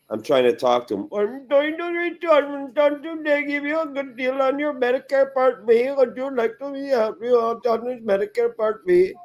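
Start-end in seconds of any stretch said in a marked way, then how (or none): 1.1-9.1 high, droning sales-call caricature; telemarketer impression. Imitating telemarketer on the phone.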